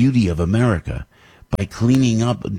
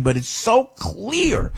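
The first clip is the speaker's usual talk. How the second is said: ranting; 11 semitones higher, much slower than usual. Real, not acted. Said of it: "Forgive me for ranting".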